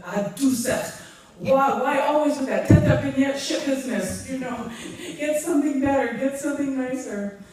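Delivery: a mock scolding from an aunt with a put-on voice; imitating angry aunt. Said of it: "she would get so angry".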